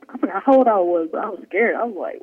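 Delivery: normal talking voice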